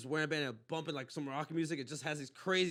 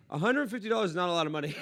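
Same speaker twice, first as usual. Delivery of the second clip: higher, louder, quick blurt; retold blurt; 4 semitones higher, 6 dB louder, faster than usual. Man blurting to woman about her price, quick retold outburst. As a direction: blurt it out without thinking.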